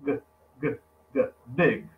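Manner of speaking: "g, g, g, big" with a clipped final g and no added vowel; final g demo. Shown as a demonstration. Teacher drilling the English g sound for the class, clipped and bare. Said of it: "A gente vai falar big".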